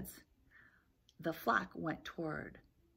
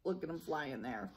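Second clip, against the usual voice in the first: plain reading voice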